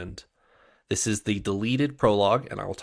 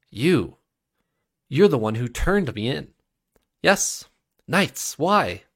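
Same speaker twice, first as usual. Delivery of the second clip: accusing, much higher and louder; 6 semitones higher, 6 dB louder, slower than usual. Acted. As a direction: rise into outrage at him. Shai confronts her betrayer.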